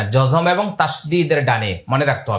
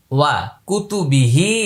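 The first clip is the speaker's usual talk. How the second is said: ওয়া কুতুবিহি broken up, pausing after "ওয়া"; word by word; much slower than usual. Shown as a demonstration. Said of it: "আমি প্রত্যেকটা আলাদা আলাদা করে বলছি". (ওয়া কুতুবিহি ভেঙে আলাদা করে পড়া)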